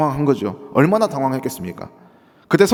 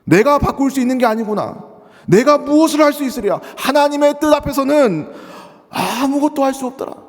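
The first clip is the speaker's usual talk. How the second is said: shaken realization; 9 semitones higher, 3 dB louder than usual. Acted. Pastor voices shaken Isaac.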